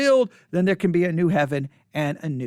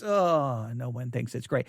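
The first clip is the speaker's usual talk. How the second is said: deflating disappointment; 7 semitones lower, 5 dB quieter than usual. Retold or imitated. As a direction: let the excitement collapse into a mutter. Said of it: "You're like".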